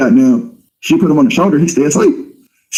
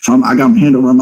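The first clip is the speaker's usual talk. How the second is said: normal talking voice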